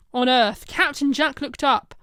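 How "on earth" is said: usual speaking voice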